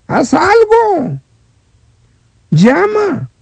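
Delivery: high, slow, pressing call; urgent plea. Real, not acted. Preacher pleads with radio listeners, high and slow. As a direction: Haz algo drawn out high, begging them to act. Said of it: "urging listeners to act".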